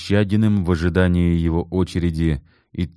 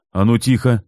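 sharp call to order; 6 semitones higher, 4 dB louder than usual. A narrator's reading. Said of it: "прикрикнул Алексей".

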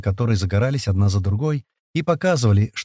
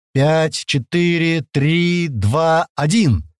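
higher, evenly called numbers; announced countdown; 6 semitones higher, much slower than usual. Acted. Kirill takes the microphone, announced countdown. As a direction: call each number out on a raised pitch.

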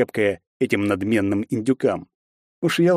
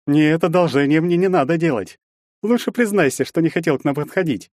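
haughty needling; 7 semitones higher than usual. A narrator's reading. Bully rejects the greeting.

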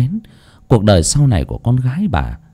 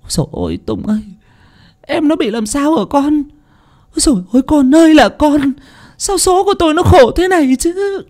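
anguished keening, much higher; wailing. Acted. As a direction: wail over her daughter's fate. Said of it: "nắm tay Tùng khóc lóc".

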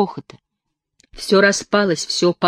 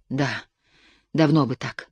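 usual speaking voice

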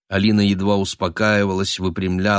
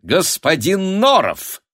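high, drawn-out merry hail; ringing cheerful greeting; 10 semitones higher, 4 dB louder, slower than usual. A narrator's reading. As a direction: greet them gaily, stretching the name. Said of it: "звучно и весело поприветствовала".

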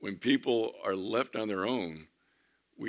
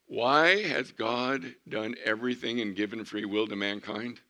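normal talking voice

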